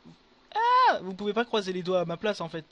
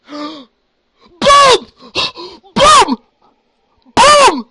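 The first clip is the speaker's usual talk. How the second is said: full-throated shriek, much higher and louder; ecstatic scream; 12 semitones higher, 23 dB louder, much slower than usual. Real, not acted. Streamer screams at a huge lucky drop, ecstatic screaming. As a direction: scream the win out at the top of your lungs. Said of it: "désolé d'avoir crié".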